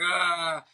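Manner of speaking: drawn-out comic "ah"; imitated pained cry. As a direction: cry out as if being bitten. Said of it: "the dog starts chewing".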